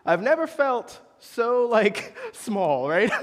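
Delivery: laughing through the words; half-laugh as he admits feeling small. Real, not acted. Preacher laughing at his own embarrassment.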